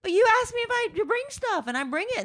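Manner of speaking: very high-pitched indignant self-quote; exaggerated high-voiced objection; mock protest. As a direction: act out a squeaky, defensive protest. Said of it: "I'm like".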